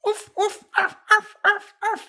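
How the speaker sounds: high yappy barks; barking sounds. Retold or imitated. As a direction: bark like a tiny dog. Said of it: "you hear just kinda like this".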